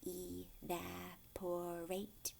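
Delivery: teacher slowly models Evaporate. for the class to repeat; slow sounding out. Shown as a demonstration. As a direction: say it slowly, syllable by syllable: e-vap-o-rate. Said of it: "Can you say that word?".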